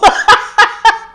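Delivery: a real high, loud burst of laughter; cracking up at the insult. Real, not acted.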